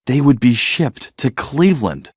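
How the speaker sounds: usual speaking voice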